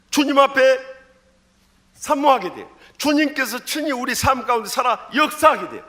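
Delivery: ordinary talk, nothing marked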